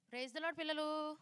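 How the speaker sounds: slow, coaxing call to the class. Shown as a demonstration. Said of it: "నాకు వినిపించట్లే అసలు".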